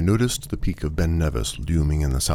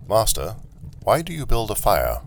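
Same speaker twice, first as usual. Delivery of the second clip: higher, lightly robotic question; inquiring automaton; 7 semitones higher than usual. Acted. Automaton asking its master about the fire, higher-pitched. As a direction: ask as a puzzled machine servant.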